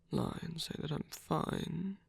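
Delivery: ordinary delivery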